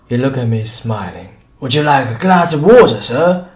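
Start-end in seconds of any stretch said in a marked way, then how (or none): 1.6-3.5 higher, louder obsequious voice; eager showman serving the doctor; fawning shopkeeper. A narrator's reading. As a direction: grovel to please the gentleman. Shopkeeper fawns over the doctor.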